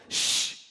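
sharp "shh" aimed at the storm; commanding shush. Acted. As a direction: hush the wind with authority. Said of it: "looks at the wind and the storm and says".

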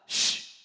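sharp, short "shh"; commanding shush. Acted. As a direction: silence the storm like unruly kids. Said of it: "looks at the wind and the storm and says".

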